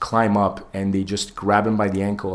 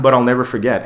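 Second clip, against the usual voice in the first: louder, higher, quick laughing line; chuckling aside, louder and quicker; 5 semitones higher, 8 dB louder, faster than usual. Real, not acted. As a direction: laugh through it.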